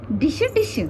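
high playful "dish dish". Real, not acted. Aunt playing with baby Mahi, high playful sound.

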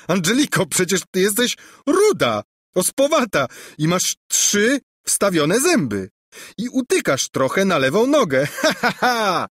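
mock her while cracking up; laughing mockery. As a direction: prince giggling as he lists her flaws. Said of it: "zataczał się ze śmiechu".

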